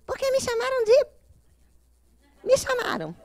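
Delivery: squeaky mock outrage of an offended girl; imitating an angry girl. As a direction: ham up the huffy young woman's complaint. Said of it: "ela chegou muito brava e falou assim".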